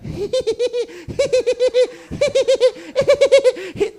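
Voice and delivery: loud high stuttering squeal; high squealed laughing. Retold or imitated. Comic mimics crashing through window, loud squealing bursts. Imitates wild play-fight noise.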